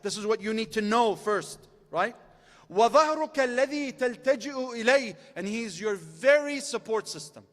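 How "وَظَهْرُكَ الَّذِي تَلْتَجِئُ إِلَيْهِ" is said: rapid recited Arabic quote; quick Arabic recitation. Acted. Preacher quoting the Imam's Arabic to the crowd, rapidly recited. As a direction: rattle off the source text.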